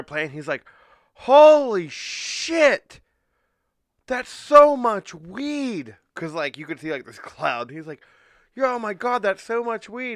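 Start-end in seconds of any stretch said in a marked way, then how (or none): 1.1-6.0 very high, drawn-out holler; imitating a singer's loud amazement; amazed stage shout. 8.5-10.1 high-pitched, loud disbelief; mock astonishment; amazed exclamation. Imitating singer amazed at weed cloud.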